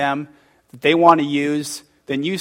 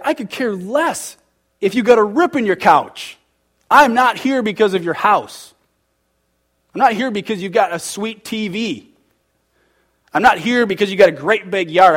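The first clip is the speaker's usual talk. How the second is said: emphatic retort; 6 semitones higher, 5 dB louder than usual. Retold or imitated. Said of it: "I was like".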